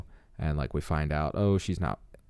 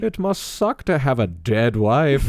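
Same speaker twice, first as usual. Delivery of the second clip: slow, higher, drawn-out pity; mock sympathetic; 6 semitones higher, 4 dB louder than usual. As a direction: fake sympathy, laid on thick. Mocking the prying wife.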